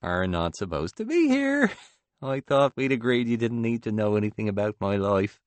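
lightly voiced husband, mock reproach; humorous scolding. A narrator's reading. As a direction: scold a friend half in jest. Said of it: "wagging his finger at me humorously".

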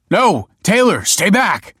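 high, loud, slow desperate warning; crying out. A narrator's reading. Man with fire to approaching friend, desperate cry. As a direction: shout to keep someone from danger. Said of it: "cried Michael".

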